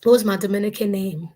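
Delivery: ordinary delivery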